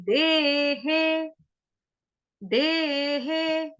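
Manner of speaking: देहे stretched very slowly with the e vowel; drawn-out देहे. Shown as a demonstration. Teacher showing the matra, slowly. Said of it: "देह के ऊपर मात्रा लगाओ एक ही".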